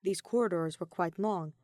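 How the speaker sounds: usual speaking voice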